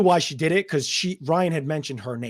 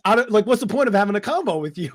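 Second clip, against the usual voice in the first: mock exasperation; 6 semitones higher, 3 dB louder than usual. Retelling frustration with Stephanie.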